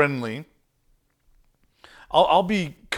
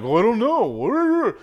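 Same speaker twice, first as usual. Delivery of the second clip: mock clueless accountant; 8 semitones higher, 5 dB louder, slower than usual. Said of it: "they're like".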